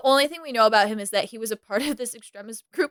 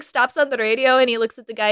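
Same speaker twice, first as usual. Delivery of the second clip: ordinary delivery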